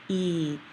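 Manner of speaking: "E" held as a long ee; long E sound. Shown as a demonstration. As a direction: draw "E" out long, like ee. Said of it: "makes a long E sound".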